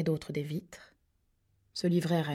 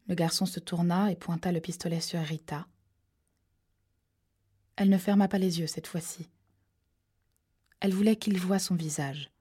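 ordinary delivery

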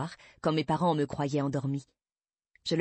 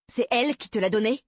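père accusateur; 6 semitones higher, 4 dB louder than usual. A narrator's reading. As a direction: question de colère, plus haute. Père furieux accuse la mère. (interrogatoire paternel furieux)

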